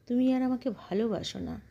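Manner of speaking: ordinary delivery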